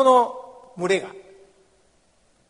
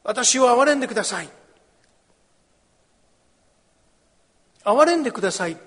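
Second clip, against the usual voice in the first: raised, higher pleading call; repeated pleading cry; 5 semitones higher, 4 dB louder than usual. Acted. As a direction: beg again and again. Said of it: "何度も何度も叫びました".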